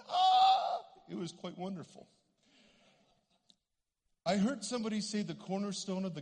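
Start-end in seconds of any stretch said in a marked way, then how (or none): 0.1-0.9 high, loud "ah" of amazement; imitated cry. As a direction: cry out as if suddenly healed. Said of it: "and she went".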